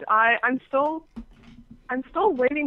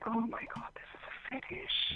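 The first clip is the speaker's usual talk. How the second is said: awed whisper; 15 dB quieter than usual. Retold or imitated. Guest retelling her realisation about the client, whispering.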